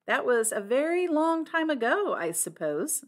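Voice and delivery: high lilt sinking into mock gravity; grave, hidden laughter. A narrator's reading. Flora teasing Blunt about his age, gravely with hidden laughter. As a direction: sound grave, with amusement underneath. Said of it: "said Flora gravely".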